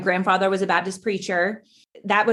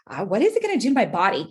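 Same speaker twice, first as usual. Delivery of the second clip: quick, pitch-swooping mock worry; vain younger self; 3 semitones higher, much faster than usual. Woman mocking her twenty-something self's fear of pregnancy, vain fretting. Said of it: "that's my twenty-something-year-old self saying".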